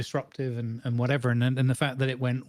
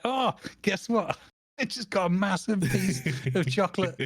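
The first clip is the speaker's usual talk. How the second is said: excited chat message; 6 semitones higher than usual. Retold or imitated. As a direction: announce the prize with giddy delight. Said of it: "I got to write in chat".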